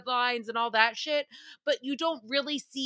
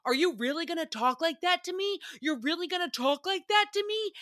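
retold yell, pitched up in disbelief; mock yelling; 8 semitones higher than usual. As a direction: imitate an outraged woman making a scene. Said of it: "she starts yelling".

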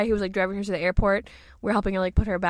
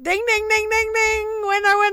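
high, sing-song game-show bell; quiz winner bell; 12 semitones higher, 4 dB louder than usual. Pretend. Quiz host hails friend's correct answer, high celebratory chant.

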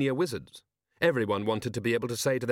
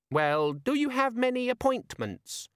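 high, slow piping question; high-pitched eager little demon; gadget demon, still trying; 13 semitones higher, slower than usual. A narrator's reading. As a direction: ask in a tiny high imp voice.

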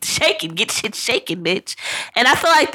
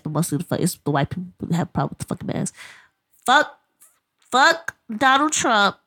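ordinary talk

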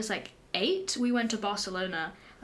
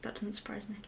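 hushed teasing aside; whispering; 10 dB quieter, faster than usual. Real, not acted. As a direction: tease them under your breath. Teasing aside about friend.

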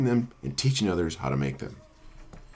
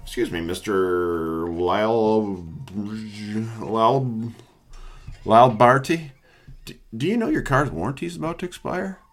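scam telemarketer. Retold or imitated. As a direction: fumble the name like a scripted caller. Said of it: "calls from".

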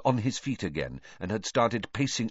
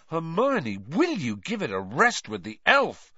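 exasperated; 9 semitones higher, 3 dB louder than usual. A narrator's reading. Ron exasperated with Hermione.

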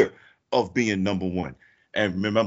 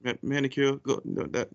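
ordinary talk, nothing marked

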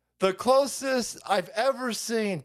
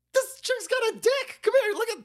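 excited. Real, not acted. High excited voice.

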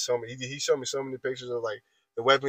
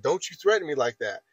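ordinary delivery

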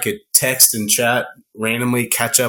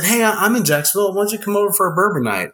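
quick, higher friendly invite, fast; quoting a friend; 8 semitones higher, much faster than usual. Retold or imitated. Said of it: "he said".

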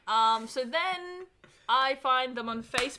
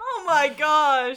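laughing; 5 semitones higher, 11 dB louder, slower than usual. Real, not acted. Recovering from scare.

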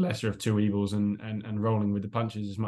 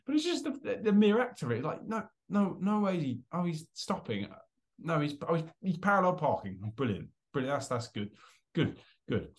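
mock disbelief; 6 semitones higher than usual. Mock disbelief watching policeman park.

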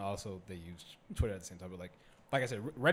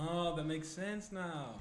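slow, higher-pitched dawning realization; hammed-up understanding; mock realization; 7 semitones higher, slower than usual. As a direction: draw it out as if it finally clicks. Voicing the crowd's sudden understanding.

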